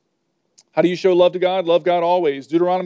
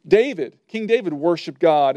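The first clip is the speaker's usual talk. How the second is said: ordinary talk, nothing marked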